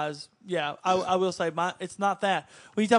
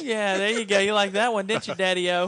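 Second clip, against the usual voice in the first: ordinary talk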